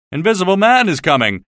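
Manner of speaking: a fool shouting a warning, voiced in passing; retold shout. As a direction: call out the warning with some force. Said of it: "ran past me shouting".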